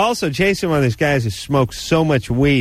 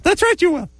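ferret voice. Pretend. Vagina puppet has last word.

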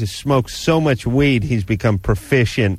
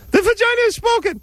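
very high squeaky puppet proclaiming; ferret voice. Pretend. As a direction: announce it like a tiny squeaky oracle. Vagina puppet pronounces verdict.

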